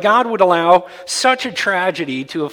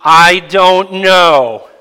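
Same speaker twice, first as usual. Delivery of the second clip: congregation repeating; 4 semitones higher, 11 dB louder, much slower than usual. Real, not acted. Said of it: "That was pathetic".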